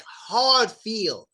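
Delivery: slow, drawn-out "feel" with a hard ee; hard long ee. Retold or imitated. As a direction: lean heavily on the ee in "feel". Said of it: "like, real hard".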